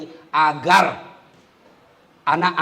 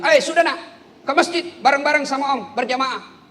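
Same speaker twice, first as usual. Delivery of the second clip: calling out; 10 semitones higher than usual. Retold or imitated. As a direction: summon the boy to the mosque. Uncle calling nephew from field.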